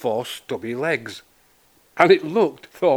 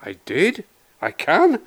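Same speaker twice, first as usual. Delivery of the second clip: rising, incredulous questions; puzzled questioning; 5 semitones higher than usual. A narrator's reading. Buster learning he flew, puzzled questioning. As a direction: end high in surprise. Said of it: "quizzed Buster".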